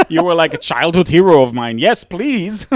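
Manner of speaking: high, loud, gushing with a laugh; giddy fanboy thrill, voice climbing; excited fan gush. Retold or imitated. Retelling meeting his childhood hero, giddy and loud. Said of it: "And I'm like".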